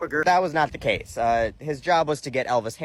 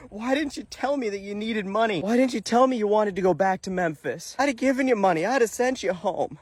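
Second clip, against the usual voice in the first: higher, half-crying disappointed plea; mock tearful parent; 7 semitones higher than usual. [name] pleading with the man who robbed him, mock tearful. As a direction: play a weepy let-down parent, lightly. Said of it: "through tears like a disappointed parent".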